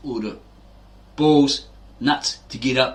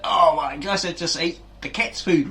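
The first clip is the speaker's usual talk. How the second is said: retold shock; 6 semitones higher than usual. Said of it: "be like".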